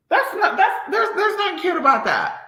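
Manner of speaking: laughing through a loud rebuke. Real, not acted.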